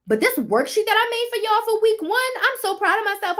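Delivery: high, bright proud squeal; proud excited brag. Real, not acted. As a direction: squeal with pride over the worksheet. Bragging excitedly.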